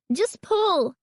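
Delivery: high-pitched wail; desperate, wailed order. A narrator's reading. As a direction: wail it out in distress. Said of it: "Carly Beth wailed".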